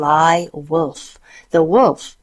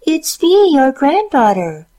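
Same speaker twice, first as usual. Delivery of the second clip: high put-on girl voice; wolf squeaking like a girl; wolf faking girl voice; 10 semitones higher, 5 dB louder than usual. A narrator's reading. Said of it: "doing his very best to sound like a young girl".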